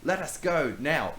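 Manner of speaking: loud high threat; threatening demand. Acted. Samantha holding knife to Brownbeard, loud threat. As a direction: order him to let go.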